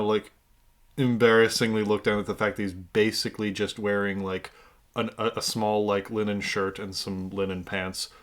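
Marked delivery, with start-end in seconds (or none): none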